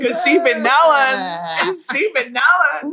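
high, slow sassy drawl; sassy hype. Pretend. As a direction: hype her up sassily. Teasing sing-song hype.

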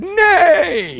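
high, drawn-out neighing "nay"; whinny the word like a horse; horse's neigh pun. Acted. Host lands his horse meat joke, neighing. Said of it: "You were about to do a nay".

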